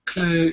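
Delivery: Claire with a Brummie vowel, nearly "Clur"; Brummie pronunciation. Shown as a demonstration. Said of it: "you gotta say Claire".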